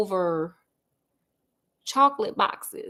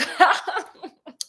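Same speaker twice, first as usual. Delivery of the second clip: short amused laugh as Holmes; mock laugh; 7 semitones higher than usual. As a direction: laugh at his own joke. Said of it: "Holmes is laughing".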